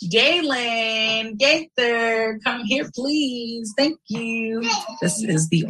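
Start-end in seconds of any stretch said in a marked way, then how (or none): none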